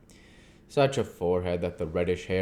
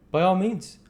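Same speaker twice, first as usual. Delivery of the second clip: put-on higher, louder voice for a polite young woman; polite young wife; 12 semitones higher, 7 dB louder than usual. Mina to the doctor, offering her hand, polite and gracious. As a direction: answer with gracious warmth.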